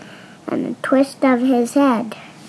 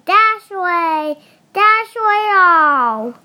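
Santa urging his reindeer upward; calling the reindeer; 5 semitones higher than usual. Acted.

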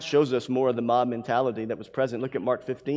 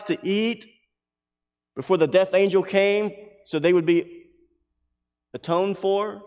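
raised, insistent preacher's voice; forceful preaching; 6 semitones higher, 6 dB louder than usual. Real, not acted. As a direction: drive it home to the congregation.

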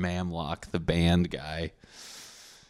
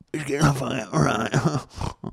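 slow, drawn-out, higher mock pronouncement; mock-formal correction; 6 semitones higher, much slower than usual. Podcaster mocks his own slip, slow and hammed up. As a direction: ham up correcting himself like a stuffy teacher.

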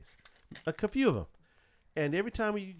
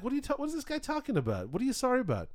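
higher-pitched, rushed bafflement; baffled; 8 semitones higher, faster than usual. Retold or imitated. Dad puzzled by son's apology on the ride, baffled. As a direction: retell it as a thrown, puzzled dad. Retelling his puzzled reaction.

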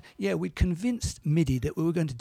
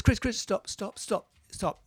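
pleading to stop; 5 semitones higher than usual. Retold or imitated. Record company halting the costly album, mock pleading. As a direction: imitate someone urgently calling a halt.

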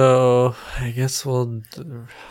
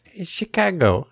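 Chicago accent, slightly higher; rough Midwest accent demo; 5 semitones higher than usual. Shown as a demonstration. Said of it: "do a Midwest accent".

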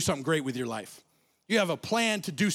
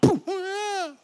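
high loud poof; mock impact poof; 12 semitones higher, 5 dB louder than usual. Boy getting flattened by the tackle, high loud poof. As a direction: burst it out like being hit.

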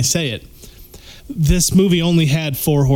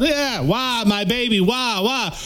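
mock crying mother; 7 semitones higher, slower than usual. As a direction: fake a grieving mother badly, pitched up. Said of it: "this woman cried".

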